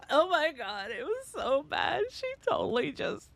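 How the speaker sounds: imitated wailing, high and loud, dragging out the words; hammed-up tearful whine; mock crying breakdown. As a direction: re-enact your over-the-top sobbing meltdown. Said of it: "this is exactly how I sounded".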